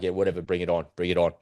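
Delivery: mock-bored defiance from Iran; dismissive. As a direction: brush off the threat like it's nothing. Voicing Iran shrugging off threat.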